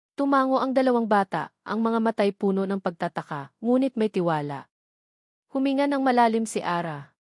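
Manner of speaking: ordinary delivery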